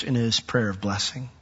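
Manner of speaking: ordinary talk, nothing marked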